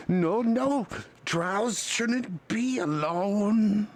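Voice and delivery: high, slow, garbled golem voice. Acted. Golem blocks a drow from browsing alone, high golem voice. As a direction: stretch the words out, hard to understand. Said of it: "he's supposed to be hard to understand".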